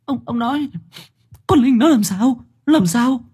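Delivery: high, choked mother begging for news. Acted. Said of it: "Bà Lan nghẹn ngào". (giọng nghẹn ngào, cao và run)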